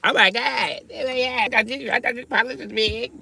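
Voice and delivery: hammed-up awestruck woman; amazed woman. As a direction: gasp it like an impressed lady.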